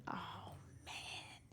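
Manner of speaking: breathy hushed oh man; whispered dismay. Retold or imitated. Singer hearing that [name] died, stunned whisper.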